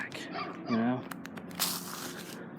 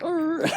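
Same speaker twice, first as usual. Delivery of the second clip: imitated dog snarl, pitched up; dog growl.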